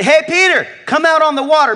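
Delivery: high, loud call across the water; voicing Jesus. Preacher voicing Jesus calling Peter out, high loud call.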